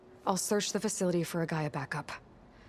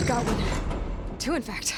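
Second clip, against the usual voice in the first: louder, pleased call of success; excited find; 4 semitones higher, 6 dB louder, much slower than usual. Acted. Aloy calls out finding Gaia backups, excited. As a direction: announce the find with a lift.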